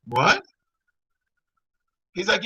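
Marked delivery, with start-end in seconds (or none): 0.1-0.4 a loud laugh into a stunned what; laughing disbelief. Real, not acted. Hearing he'll be alone for years, a loud laughing what. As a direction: laugh it off in shock, loudly. Psychic's shocking prediction.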